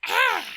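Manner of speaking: high, loud silly yelp; a daft squeal from school days; stupid voice yelp. Retold or imitated. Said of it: "a stupid voice I used to do".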